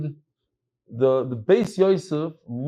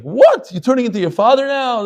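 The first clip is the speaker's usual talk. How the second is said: high, loud, shocked outburst; shocked wife; 9 semitones higher, 7 dB louder than usual. Retold or imitated. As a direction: shout it like you caught him doing something crazy. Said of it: "She's like".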